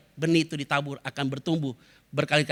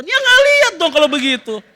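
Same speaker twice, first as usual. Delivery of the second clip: high, loud exasperated retort; mock exasperation; 9 semitones higher, 9 dB louder than usual. Pastor answers member who claims seeing something, mock exasperated. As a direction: act out a loud, high-pitched comeback.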